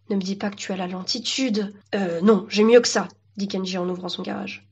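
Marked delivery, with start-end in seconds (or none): none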